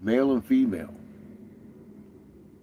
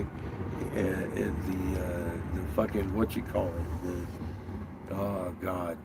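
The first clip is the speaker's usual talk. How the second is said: ordinary talk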